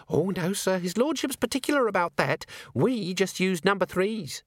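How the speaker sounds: put-on high young maid voice.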